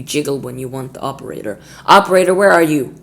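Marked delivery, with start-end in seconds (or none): 1.9-2.9 loud, high, repeated hellos; impatient phone shouting. Acted. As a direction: bark for the operator.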